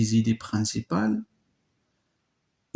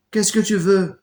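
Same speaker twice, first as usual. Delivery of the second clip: sharp retort; 8 semitones higher, 6 dB louder than usual. Acted. Antigone answers sister sharply.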